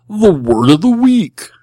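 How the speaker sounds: big, loud, drawn-out announcement; segment announcer. Pretend. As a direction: sing out the segment title, high and slow. Announcing a podcast segment title.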